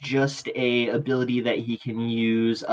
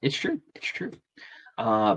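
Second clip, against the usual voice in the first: ordinary talk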